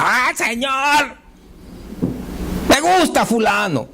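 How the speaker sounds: high, long-drawn wail to the Lord; mock crying out. Believer at home crying a crush out to God, mock holler. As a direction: act out a believer hollering a need in prayer. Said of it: "si usted va a gritar su necesidad".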